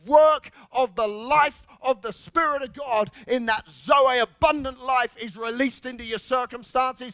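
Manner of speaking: loud, rising sermon drive; preaching at full force. Acted. Preacher declaring abundant life over his listeners. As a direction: press the promise home.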